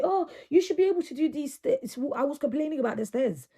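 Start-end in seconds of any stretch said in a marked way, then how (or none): 0.0-2.0 higher-pitched mock reproach; scolding onlooker. Person criticising her struggle on the stairs, mock scolding. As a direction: imitate someone chiding you, pitched up. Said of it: "I had somebody tell me".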